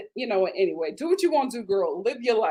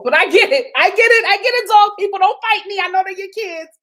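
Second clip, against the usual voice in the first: loud, high playful appeal; mock defensive; 10 semitones higher, 11 dB louder than usual. Real, not acted. Vlogger to dog people, loud mock-defensive plea. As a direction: plead with dog lovers playfully.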